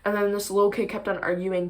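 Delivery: ordinary talk, nothing marked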